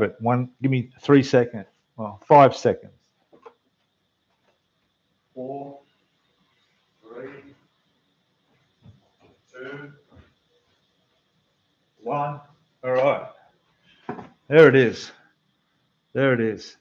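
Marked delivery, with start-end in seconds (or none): 5.3-12.4 soft, spaced-out count from away from the mic; distant countdown. Real, not acted. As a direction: count down slowly from across the room. Counts down while fetching the bottle.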